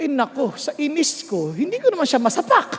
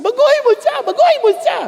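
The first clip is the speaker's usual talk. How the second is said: higher, louder insistent demand; demanding shout; 10 semitones higher, 4 dB louder than usual. Retold or imitated. A believer demanding God change their spouse, insistent shout.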